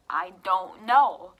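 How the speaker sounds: loud, slow, spaced-out words; exasperated answer. Retold or imitated. Patient asked the pen's color, exasperated. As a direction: stretch each word loudly in exasperation. Can't see the pen held up.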